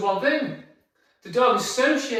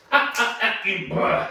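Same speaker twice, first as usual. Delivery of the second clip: staccato ahs into a growled brr; stern, wordy-noise reprimand; sharp dog correction; 3 semitones higher than usual. Real, not acted. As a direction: warn the dog off abruptly.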